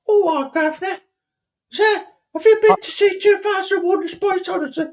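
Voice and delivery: very high, squeaky mock-Cockney Derby urchin; mock Derby accent. Derby local asking his governor about a film, mock accent. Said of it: "in Derby, they also speak like".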